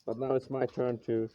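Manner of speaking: muffled behind a respirator mask; through a respirator. Real, not acted. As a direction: talk through a face mask. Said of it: "I don't know if you can hear me through the respirator".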